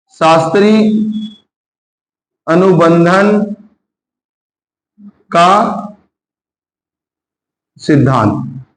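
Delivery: very slow, a long pause after each word; word-by-word dictation. Real, not acted. Teacher giving the class a theory name, slowly dictated. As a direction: spell out the term for note-takers, dropping on the last word.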